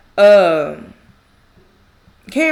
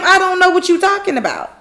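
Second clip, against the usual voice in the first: high, raised-voice retold protest; clear insistent denial; 9 semitones higher, 4 dB louder than usual. Karen clearly telling Zach, insistent denial. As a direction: spell it out at high pitch. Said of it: "clearly telling him".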